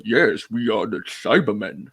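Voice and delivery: slow, put-on robotic monster voice; slow, dark mechanical delivery; Cybermen. Shown as a demonstration. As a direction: intone it like a sci-fi cyborg. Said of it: "that kind of voice".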